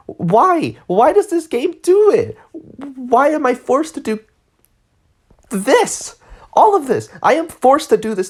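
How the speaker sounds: high, slow, frustrated pleading why; fed-up gamer venting at the game; exasperated rant. Real, not acted. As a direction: throw the question up in disbelief. Ranting about forced side quest.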